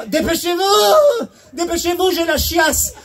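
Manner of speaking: high, urgent mock panic; desperate plea. Man at locked toilet pleading to hurry, high mock panic. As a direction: beg through the door.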